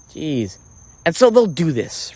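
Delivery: ordinary delivery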